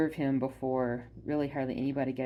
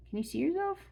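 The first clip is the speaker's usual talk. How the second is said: much higher, sing-song pet talk; cooing to the dog; 13 semitones higher, 3 dB quieter, faster than usual. Real, not acted.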